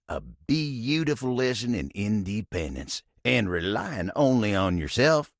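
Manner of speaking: ordinary talk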